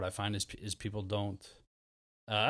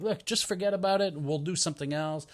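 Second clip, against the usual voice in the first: higher-pitched, brush-it-off reassurance; breezy consoling; 7 semitones higher than usual. Retold or imitated. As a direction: imitate cheering up a sad friend. Acts out distracting a sad friend.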